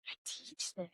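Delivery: whispered inner voice; hushed self-reproach. Retold or imitated. Helper privately scolding herself, whispered self-reproach. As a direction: whisper it as a private, ashamed thought.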